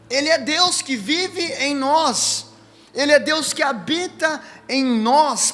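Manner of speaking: slow, measured preaching, every word weighed; preaching proclamation. Acted. Pastor declaring to the church that the Spirit is God, preaching slowly.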